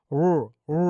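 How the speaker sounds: ও, ও as a rounded untrilled "r-o", twice with a pause; isolated English R. Shown as a demonstration. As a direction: demonstrate the English r sound in ও, ও. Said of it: "এরকম একটা উচ্চারণ হয়".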